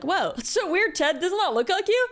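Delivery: high, playful mock-surprised voice; teasing friends. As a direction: tease him like a friend would. Friends teasing Ted about sketch.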